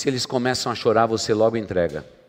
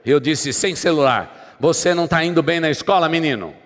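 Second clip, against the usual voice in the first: loud, raised-pitch parental telling-off; mock parental rant at full volume; scolding parent; 6 semitones higher, 7 dB louder than usual. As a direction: lay down the law as an acted angry parent. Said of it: "Aí você diz".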